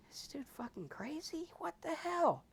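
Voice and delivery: can't believe what he just heard; baffled disbelief. Acted. Voicing your baffled reaction.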